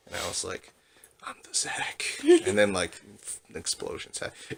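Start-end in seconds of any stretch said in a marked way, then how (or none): 1.2-2.0 hushed, unvoiced-style catchphrase delivery; a hint of whispered movie-hero swagger; cool action-hero line. Dream hero's cool catchphrase, acted.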